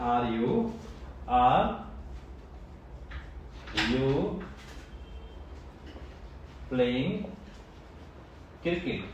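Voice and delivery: each word split off by long pauses. Shown as a demonstration. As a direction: spell it out slowly, one word at a time. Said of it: "simple.".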